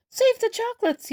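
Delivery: high, fast urgent cry; yelled plea. Acted. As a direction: cry out to save them. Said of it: "yelled the man".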